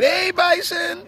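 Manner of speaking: high, drawn-out call to an animal; mock tourist calling. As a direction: imitate a tourist cooing at a bison, stretched out. Acting tourists petting a bison.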